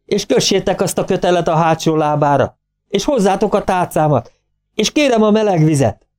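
magas hangon sorolt, sürgető parancsok; végig emelt hangú kiabálás, teljes hangerő nélkül; üvöltés. A narrator's reading. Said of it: "üvöltötte". (türelmetlenül kiáltott utasítások)

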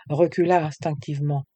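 usual speaking voice

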